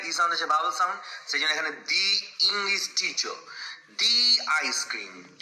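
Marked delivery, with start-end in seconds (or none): 3.9-5.4 slow "দি আইসক্রিম" with a long "দি"; the before a vowel. Shown as a demonstration. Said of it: "আইসক্রিমের আ sound আসছে".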